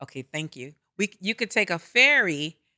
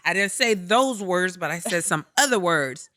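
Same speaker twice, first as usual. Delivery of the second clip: usual speaking voice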